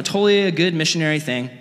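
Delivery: normal talking voice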